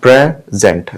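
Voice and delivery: "Present" split as PRE, zent; broken into syllables. Shown as a demonstration. Teacher showing the break, "present" in two pieces. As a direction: pause between the halves of "present". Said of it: "दो जगहों पे तोड़ा".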